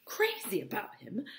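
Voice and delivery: high, disbelieving outburst; incredulous cry. A narrator's reading. Myrtle rejecting her sister's claim, incredulous. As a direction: throw the words back in disbelief. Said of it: "cried Myrtle incredulously".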